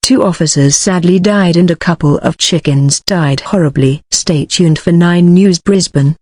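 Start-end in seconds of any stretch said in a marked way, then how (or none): none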